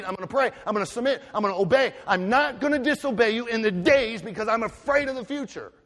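fast, high, loud vow to God; urgent believer promising God; fervent prayer. Acted. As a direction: rattle off the vows with fire. Preacher voicing believer's prayer.

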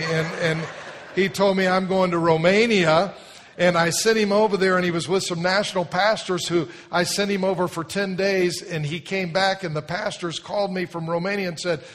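loud, fast, fired-up preaching; full-force preaching. Acted. Pastor tells congregation about Tony, fired-up preaching. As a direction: preach it with fire to the crowd.

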